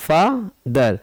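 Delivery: "father" pulled apart as fa, ther; split into syllables. Shown as a demonstration. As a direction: pause between the two syllables of "father". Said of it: "यहाँ पर दो syllable हो गए".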